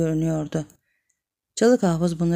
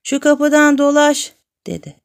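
startled urging; 7 semitones higher, 7 dB louder than usual. A narrator's reading. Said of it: "sıçrayıp".

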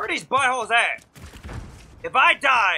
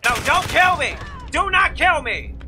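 urgent protest; 3 semitones higher than usual. Real, not acted. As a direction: beg the game not to kill you. Protesting as enemies attack in game.

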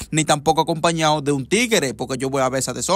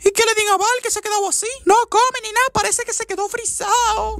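put-on a high squeaky girlfriend voice; high girly voice. Girlfriend refusing zombie films in a high squeaky voice. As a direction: pipe it up high like a fussy young woman.

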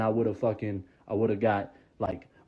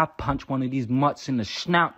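ordinary delivery

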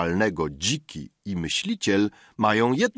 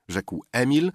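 ordinary delivery

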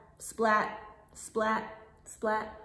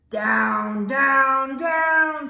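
dramatic plunge; 9 dB louder, much slower than usual. Acted. Kite plunges in story.

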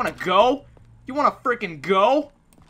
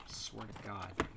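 exasperated mutter; 13 semitones lower, 20 dB quieter than usual. Real, not acted. Muttering to himself.

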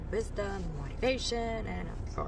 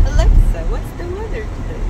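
clear, raised command to Alexa; talking to voice assistant; 7 semitones higher, 7 dB louder than usual. Real, not acted. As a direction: speak up to the smart speaker. Speaker addresses Alexa smart speaker.

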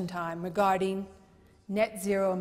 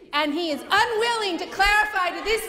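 indignant; 9 semitones higher, 6 dB louder than usual. Real, not acted. Heated rebuttal in chamber debate.

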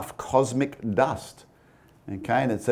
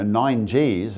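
normal talking voice

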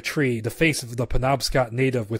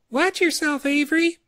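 much higher, put-on kid voice calling out; child's call; 13 semitones higher than usual. A kid on the logs calls to Avery, high-pitched. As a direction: call out like a playful pilgrim kid.

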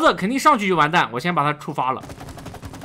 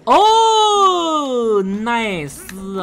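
slow stretched excited cheer; drawn-out cheer; 4 semitones higher, 5 dB louder, much slower than usual. Real, not acted. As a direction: savor the win. Cheering a good move.

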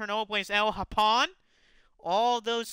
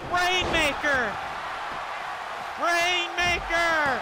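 drawn-out finisher call; 8 semitones higher, slower than usual. Acted. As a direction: stretch the name out like a big finish. Calling the finishing move.

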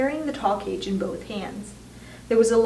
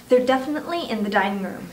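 ordinary delivery, nothing marked

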